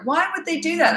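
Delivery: high mock-puzzled parent question; bewildered parent. Hired parent asking the coach, high and baffled. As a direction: mimic the baffled client parent.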